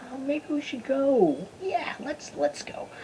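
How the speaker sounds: high, soft, tentative voice; acting out a timid suggestion, very softly; hesitant quote. Acting out Jacob deciding to leave.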